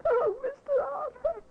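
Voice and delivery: high, tearful plea; frightened crying. Acted. Maid confessing to Mister Arthur, high and tearful. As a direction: voice breaking with fear for her master.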